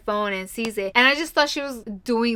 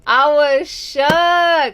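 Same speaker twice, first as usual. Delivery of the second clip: stunned; 5 semitones higher, 8 dB louder, much slower than usual. Real, not acted. Recalling a twist in the book.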